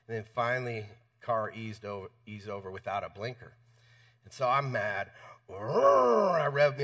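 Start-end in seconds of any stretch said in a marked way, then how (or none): 5.5-6.8 mock engine revving, slow and loud; engine rev noise. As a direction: imitate revving a big truck engine. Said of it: "had to show that I was angry".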